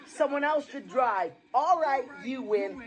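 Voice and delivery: normal talking voice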